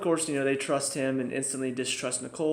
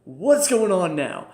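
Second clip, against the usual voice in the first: shocked; 4 semitones higher, 6 dB louder, slower than usual. Retold or imitated. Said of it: "And so just like".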